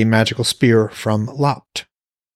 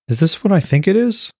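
amazed woman; 10 semitones higher than usual. Acted. Alara astonished at fruit.

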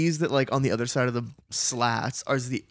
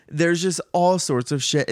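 ordinary delivery

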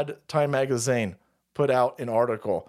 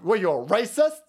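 usual speaking voice

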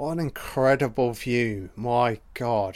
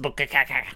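beatboxing a breakbeat; 2 semitones higher, faster than usual. Retold or imitated. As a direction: copy the song's beat with your mouth like a comic echo. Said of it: "You know that breakbeat".

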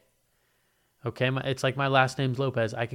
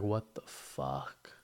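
stunned mutter; 10 semitones lower, 10 dB quieter, much slower than usual. Retold or imitated. Stunned at coughing waitress.

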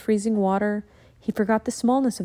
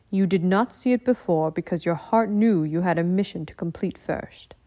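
calm ninja master; 2 semitones lower than usual. Acted. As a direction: speak like a wise old teacher.

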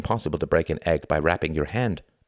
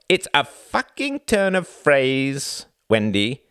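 slow, higher-pitched condescending lecture; headmaster condescension; 8 semitones higher, 3 dB louder, much slower than usual. A narrator's reading. Husband corrects his wife's reading of a phrase, condescending. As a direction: spell it out like a patronising headmaster. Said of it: "he said with headmaster condescension".

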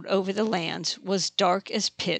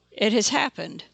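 ordinary delivery, nothing marked

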